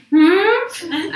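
high, loud mock "hmm"; startled squeak. Actress recognised by the show's creator, mock startled hum. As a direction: imitate a caught-out squeak. Retells reaction to being recognised.

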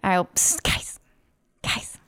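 hushed psst to the group; whisper. Pretend. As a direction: whisper to get their attention. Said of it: "Let's whisper a little louder".

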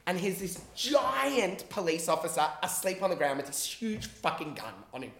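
ordinary delivery

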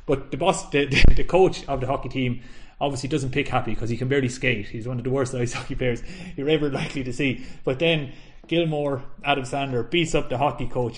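ordinary talk, nothing marked

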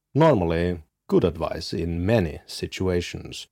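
low, measured host-read ad; podcast sponsor read. Acted. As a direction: deliver a calm, deliberate sponsor message. Host reads a sponsor message.

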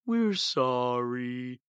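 slow, drawn-out mock sorry; hollow apology. The parody CEO chopping wood, slow hollow sorry. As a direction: drag out an empty sorry. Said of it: "just saying".

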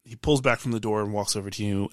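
normal talking voice

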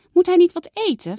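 put-on a child fussing over the kitten's hunger; worried girl.

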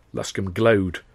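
ordinary delivery, nothing marked

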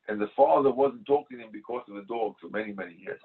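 normal talking voice

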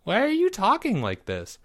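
put-on high-pitched kid voice; puzzled, pitched well up; baffled young boy. A narrator's reading. Boy baffled by friend's grand talk, high and bewildered.

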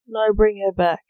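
loud, insistent order; narrated firm command; demanding. A narrator's reading. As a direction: demand it firmly. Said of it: "demanded Jack".

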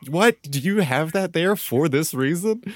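high-pitched, drawn-out incredulous question; retold disbelief. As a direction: squeal the question in mock astonishment. Retells own disbelief at hidden map.

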